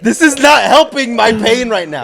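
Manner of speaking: high, loud, pained protest; exasperated yell. Retold or imitated. Son in pain shouting back at his dad, high and loud. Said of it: "I'm like".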